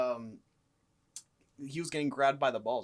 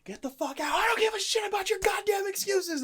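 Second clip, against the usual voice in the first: high-pitched furious shout; loud reenacted outburst, building; angry yelling; 7 dB louder than usual. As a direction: yell them out of the room. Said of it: "just yelling at this dude".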